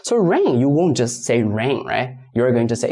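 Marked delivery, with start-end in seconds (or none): none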